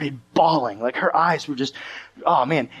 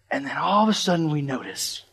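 normal talking voice

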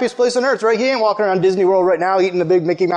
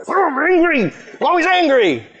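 a light, hammed-up Hulk voice, raised in pitch; mock Hulk; 7 semitones higher than usual. Preacher mocks someone who turns into the Hulk, hammed-up. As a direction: play a grumpy Hulk, only half serious. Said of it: "turn into the Hulk".

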